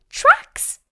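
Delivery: very high, loud excited cry; Peppa spotting tracks. Acted. Peppa discovering animal tracks on the walk, high excited squeal. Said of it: "she says".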